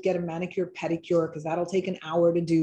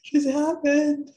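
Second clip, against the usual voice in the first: surprised; 7 semitones higher, 3 dB louder, slower than usual. Real, not acted. Yawn comes during exercise.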